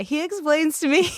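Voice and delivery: on the verge of tears; close to crying. Real, not acted. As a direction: start to well up as you speak. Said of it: "maybe I will cry".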